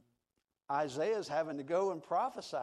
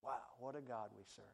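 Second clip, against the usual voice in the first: ordinary delivery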